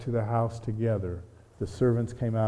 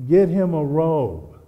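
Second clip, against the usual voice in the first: father's command; 4 semitones higher, 8 dB louder, slower than usual. Acted. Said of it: "he said".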